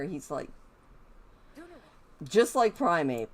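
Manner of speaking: usual speaking voice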